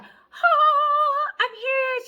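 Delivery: very high, bright chirpy greeting; imitating the friend's giddy high hello; bubbly Tanya impression. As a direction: burst in like a ray of sunshine. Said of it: "She's just like".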